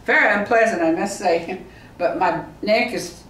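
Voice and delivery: ordinary talk, nothing marked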